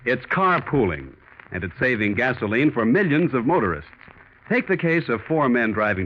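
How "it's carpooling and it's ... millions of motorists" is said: ordinary talk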